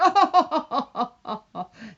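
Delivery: loud, forced, hammed-up laugh; mock someone's put-on laugh; fake laugh. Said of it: "this fake laugh".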